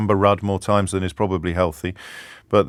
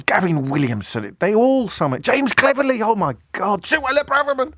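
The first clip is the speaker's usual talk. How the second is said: exasperated rising list; 11 semitones higher than usual. Real, not acted.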